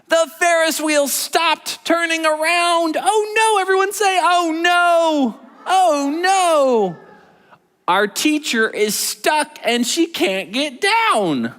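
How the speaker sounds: high, slow, alarmed storytelling; dramatic read-aloud. Acted. Teacher reads the stuck-teacher scene to preschoolers, high and alarmed. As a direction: sound worried for the stuck teacher.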